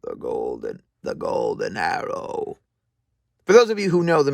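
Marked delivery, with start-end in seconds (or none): 0.0-2.6 low, rough showman alligator voice; alligator presenter voice. Shown as a demonstration. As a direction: growl it out like a crocodile host. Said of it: "alligator presenter voice".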